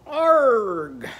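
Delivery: "Arg" with a long loud falling "ar"; vowel swapped to "ar". Shown as a demonstration. Teacher shows egg with Arnie's vowel, long loud "Arg". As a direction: draw out "Arg", high then sliding down. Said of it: "it's going to sound like this".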